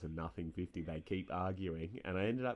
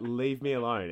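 loud mock retort, raised pitch; retold snap; 5 semitones higher, 9 dB louder than usual. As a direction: fire it back at him, louder and higher. Retorting to a taunting opponent.

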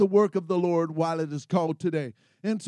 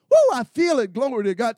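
excited; 2 semitones higher, 8 dB louder, faster than usual. Real, not acted. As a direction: burst out feeling the spirit. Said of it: "That's why I'm excited".

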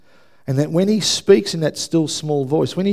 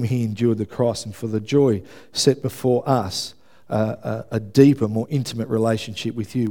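softer, lower praying voice; prayer; 3 semitones lower, 3 dB quieter than usual. Real, not acted. Preacher thanking Father God on behalf of the church, hushed prayer. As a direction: speak quietly and earnestly to God.

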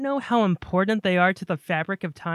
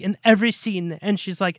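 normal talking voice

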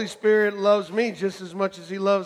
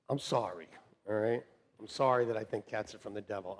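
mock apology; 10 semitones lower, 11 dB quieter than usual. Real, not acted. Mock apology to pet lovers.

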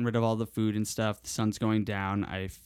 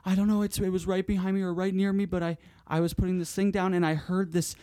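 higher, hammed-up panicky partner; imitating Lily; 8 semitones higher than usual. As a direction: retell her frightened babble in a raised voice. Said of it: "And then she's like".

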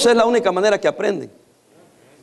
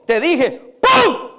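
calm warning, then a big high "pum" for the gunshot; warning then bang; 6 semitones higher, much slower than usual. Retold or imitated. Pastor acting out the old woman firing, mock bang. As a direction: tell him, then fire the shotgun. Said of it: "le dijo".

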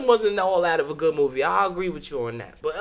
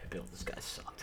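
whisper; 20 dB quieter than usual. Pretend. Mock NFL insiders gossiping.

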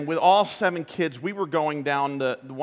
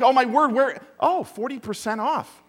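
higher, delighted gasp at a sale; higher mock shopper squeal; distracted shopper; 8 semitones higher, 4 dB louder than usual. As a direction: get swept away by a bargain. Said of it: "It's not like".

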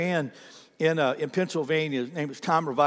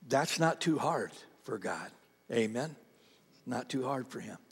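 softer and lower, stressing NOT and TOO; quiet reassurance; 5 semitones lower, 10 dB quieter than usual. Real, not acted. Pastor softly assuring the church nothing is too hard for God. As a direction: settle the room down gently.

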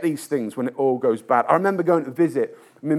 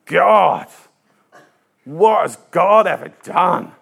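louder, scornful mock of a cynic; cynical scoff; 6 dB louder, slower than usual. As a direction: scoff like the doubting brother. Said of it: "his brother was so cynical".

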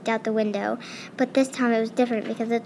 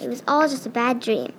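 plain reading voice

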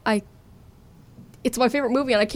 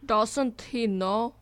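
slow, drawn-out mocking echo; mimicking the co-host; 5 dB quieter, much slower than usual. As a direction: parrot it back very slowly. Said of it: "That's what you said".

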